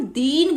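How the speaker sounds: दीन with a long, stretched ee; drawn-out vowel. Shown as a demonstration. Teacher explaining the long ee matra, दीन drawn out. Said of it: "खींचकर बोलेंगे".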